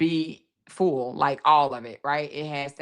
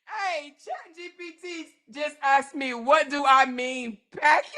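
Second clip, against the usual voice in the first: cry-laughing high and breathless through the words; real helpless laughter, words squeezed out between laughs; tearful wheezing laugh, voice pitched up; laughing till crying; 10 semitones higher than usual. Real, not acted. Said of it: "I'm about to die over here".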